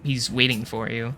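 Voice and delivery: usual speaking voice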